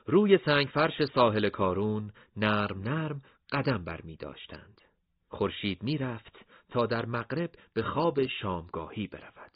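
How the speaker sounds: usual speaking voice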